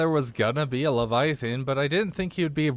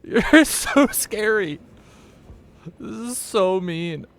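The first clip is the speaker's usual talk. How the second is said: mock scared; 9 semitones higher, 8 dB louder, slower than usual. Mocking the Leviathan.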